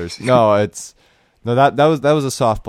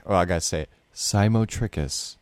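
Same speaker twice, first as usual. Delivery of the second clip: ordinary talk, nothing marked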